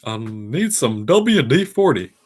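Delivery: high, slow, loud cartoon dad; Hank Hill impression. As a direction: ask for it like a deadpan handyman. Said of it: "that one's fun to do".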